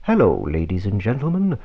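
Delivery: usual speaking voice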